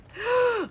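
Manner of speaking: high, sharp gasp; delighted gasp. Real, not acted. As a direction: suck in air, thrilled. Sees the next gift.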